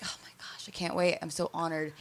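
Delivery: softly whispered, starstruck gushing; hushed awe. Retold or imitated. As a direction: whisper it like an awed fan. Retells starstruck awe before the scene.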